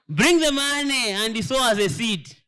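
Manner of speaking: a hint of a pulpit money plea, drawn out; lightly mimicking a preacher asking for seed money; mock greedy preacher. Mocking pastors who demand money.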